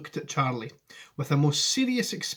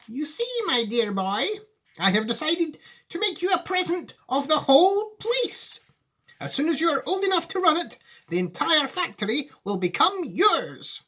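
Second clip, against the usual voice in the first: high, fond Wonka voice; an old showman sharing a grand surprise; kindly Wonka; 14 semitones higher, 4 dB louder than usual. Acted. Wonka telling Charlie he gets the factory, high and fond.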